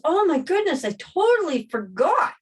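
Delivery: high, loud mock gasp of realization; retold sudden realization. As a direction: act out a cashier suddenly remembering. Retold cashier suddenly remembering.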